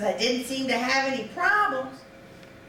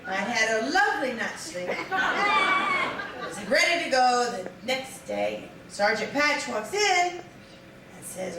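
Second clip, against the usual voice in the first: normal talking voice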